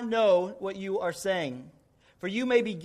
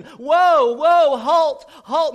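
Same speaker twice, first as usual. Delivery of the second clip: panicked yells, much higher and louder; screaming in panic; 7 semitones higher, 13 dB louder, much slower than usual. Retold or imitated. Rider heading for a cliff, panicked screaming. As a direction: scream at the horse before the cliff. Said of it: "He's just screaming".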